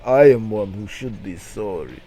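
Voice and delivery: lightly voiced heavy Russian accent; a hint of a formal Russian accent, apologising; thick Russian accent. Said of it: "in a thick Russian accent".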